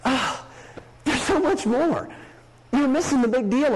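mild mock disappointment; disappointed sigh. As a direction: sigh it out, let down. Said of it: "there's this sigh of".

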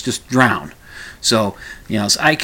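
usual speaking voice

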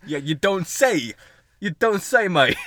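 loud, drawn-out mock British; British accent. Playing a lover who suddenly turned British, British accent. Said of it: "She turned British".

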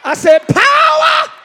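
high, loud ecstatic cries; spirit-filled shouting; ecstatic tongues shout. Real, not acted. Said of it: "I'm sorry".

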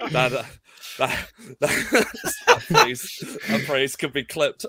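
talking through real laughter; laughter breaking up the words; laughing. Real, not acted. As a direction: struggle to speak while cracking up. Cracking up at co-host's joke.

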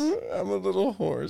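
high, slow pony voice; high squeaky little horse. Retold or imitated. Punchline redone laughing.

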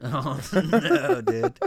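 high laughing "no dude"; laughing protest. Real, not acted.

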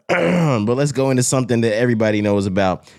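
ordinary talk, nothing marked

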